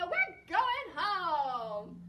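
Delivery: loud, high, drawn-out cheer; Romeo. Acted. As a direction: stretch it out in joyful triumph. Hero rejoices at going home.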